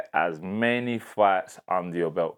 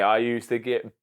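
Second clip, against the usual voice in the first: normal talking voice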